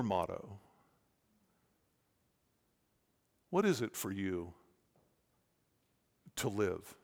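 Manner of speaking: soft, low, with long pauses; hushed, reflective. Real, not acted. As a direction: let each searching question sink in quietly. Preacher turns to searching, reflective questions.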